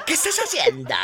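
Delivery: laughs through the repeated question; laughing. Real, not acted. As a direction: asks again, cracking up. (pregunta entre carcajadas)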